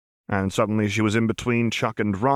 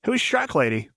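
Jinx, needling; 9 semitones higher than usual. A narrator's reading. Jinx eyes the stranger.

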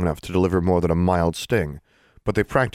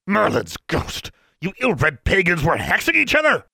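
bellowing Mister Brown; 12 semitones higher than usual. A narrator's reading. Outraged official bellows at the students. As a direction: roar it across the room like an outraged official.

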